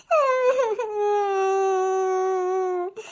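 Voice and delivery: drawn-out bawling cry; long mock wail. Scolded daughter bawls after mother's threat, long wailing cry. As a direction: let out one long, childish wail. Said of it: "ஒப்பாரி தான் வச்சிட்டு இருக்கியா".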